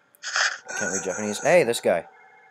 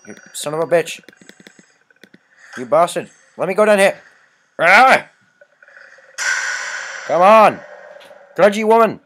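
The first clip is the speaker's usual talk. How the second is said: yelling curses at the game, much higher and louder; furious shouting; 9 semitones higher, 12 dB louder than usual. Real, not acted. Stuck player screaming at the grudge ghost, furious yelling. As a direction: scream in real frustration at the controls.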